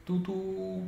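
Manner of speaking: short "tu" then long high "tu"; only the second "tu" is stretched; bus horn imitation. Shown as a demonstration. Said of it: "a leitura dessa frase aqui ... seria". (buzina de ônibus: só o segundo "tu" esticado)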